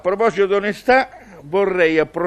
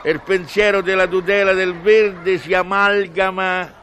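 ordinary talk

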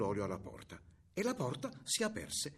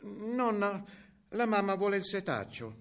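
lightly voiced, higher little girl; 6 semitones higher than usual. A narrator's reading. Girl asking grandmother for the sieve, lightly voiced child.

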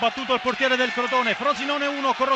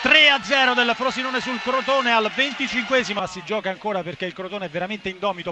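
ordinary talk, nothing marked

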